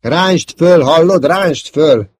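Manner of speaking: loud, high, drawn-out desperate command; yelling. A narrator's reading. Wingman begging his partner to pull up, yelling. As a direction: yell desperately for him to pull up. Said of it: "ordította a f- társa".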